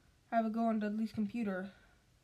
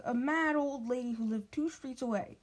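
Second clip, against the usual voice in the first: plain reading voice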